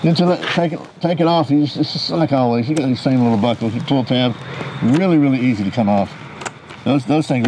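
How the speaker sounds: muffled behind face mask; talking through a gas mask. Real, not acted. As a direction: voice through respirator. Seen 5: Full-face respirator worn throughout; lifted off around 19.3 s.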